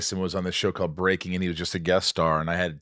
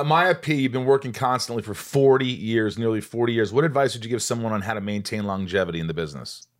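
reading a question; 3 semitones higher than usual. Acted. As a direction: read it out like a podcast host.

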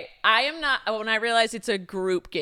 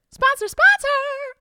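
high, slow sing-song call; 5 dB louder, slower than usual. Acted. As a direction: call it out like a game show. Calling out for sponsor.

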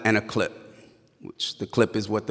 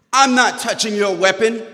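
high, loud indignant cop; forceful refusal; 12 semitones higher, 6 dB louder than usual. Retold or imitated. Officer refusing to check the driver's gun, loud and adamant.

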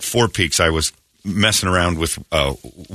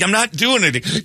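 higher, raised-voice denial; mock indignant yell; defensive protest; 12 semitones higher than usual. Defending self in quarrel.

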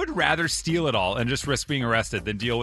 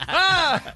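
mock scream; 13 semitones higher than usual. Mimicking [name]'s fall.

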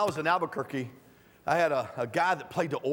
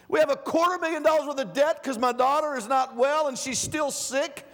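higher, louder indignant heckler arguing with the text; mock objector; 10 semitones higher, 5 dB louder than usual. Preacher playing a doubter talking back to Paul, indignant. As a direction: scoff at the verse like a fed-up believer.

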